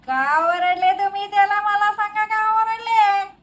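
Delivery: high indignant yell, rising steadily, last words stretched; hammed-up protective mother hollering; escalating outrage. Mother escalates confronting father.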